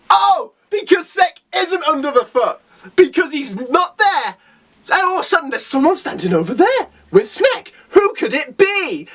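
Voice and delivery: rising, breathless excitement over the twist; excited reveal. Real, not acted. Hero's surprise arrival retold.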